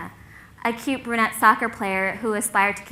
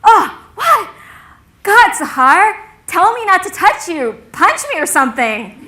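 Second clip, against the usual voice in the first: high, loud, flustered exclaiming; aghast outburst; 9 semitones higher, 11 dB louder than usual. Retold or imitated. Logan after hugging his friend again, aghast outburst. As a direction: burst out, horrified at your own slip.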